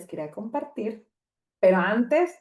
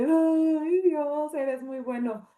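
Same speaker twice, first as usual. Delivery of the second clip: high, tearful mock wail; acted-out weepy gratitude to God; imitated crying; 9 semitones higher, much slower than usual. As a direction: wail it high as if weeping, then soften. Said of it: "yo llorando así de".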